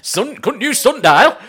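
high, loud, slow, laughing through the joke. Real, not acted. Joking with his co-host about sundials, laughing hard.

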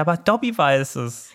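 a hint of an eager little elf; Dobby. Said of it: "dann sagt er".

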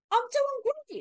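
high posh British "I'm doing great"; chirpy British impression; British accent. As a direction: mimic a British actor in an interview. Mimicking British actor in interview.

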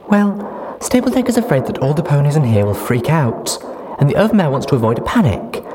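put-on high, loud, giddy young mare. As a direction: chatter happily about the future. Duct Tape explaining.

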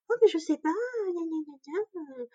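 high, whiny mock of the midwife's excuse. Mocking the midwife who excuses herself to the doctor, high and whiny. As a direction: parody her lame excuse, then trail off into gna gna.

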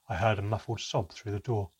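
ordinary delivery, nothing marked

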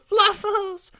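very high, drawn-out wail; wailed cry. Acted. Jeffrey calling for his missing kitten, high wail. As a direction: cry out for a lost pet. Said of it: "Jeffrey's loud cries of".

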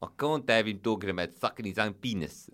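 stern South African agent defending his client; South African accent. Acted. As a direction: lay down the law in a put-on South African accent. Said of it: "This is what he said".

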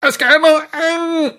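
high, garbled desperate shout; utydeligt råb. A narrator's reading. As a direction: shout it with a burnt tongue. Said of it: "råber faren". (højt, desperat råb med brændt tunge)

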